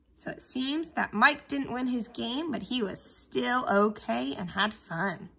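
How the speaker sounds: ordinary talk, nothing marked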